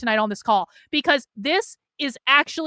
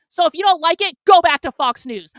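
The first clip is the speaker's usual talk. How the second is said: loud, higher angry outburst; furious yell at her critics; angry shout; 4 semitones higher, 7 dB louder than usual. Real, not acted. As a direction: shout them down in real anger.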